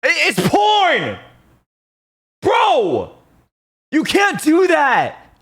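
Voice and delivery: very high, loud, slow yells; outraged shout. Real, not acted. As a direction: scream at the poster in outrage. Porn reply under his tweet.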